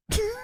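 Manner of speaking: high imitated bell; ping it out high like a machine; mock test-machine ding; lie detector ding. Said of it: "a lie detector test go".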